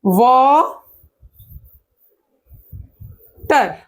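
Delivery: "Water" with a very long pause between वा and टर; split into syllables. Shown as a demonstration. Teacher showing how a word divides, water pulled far apart. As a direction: stretch वा, wait, then drop टर. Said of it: "कैसे?".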